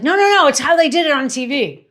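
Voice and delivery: retold self, dismissively overruling the husband; insistent protest. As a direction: wave off the doubter, sure of yourself. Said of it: "and I was like".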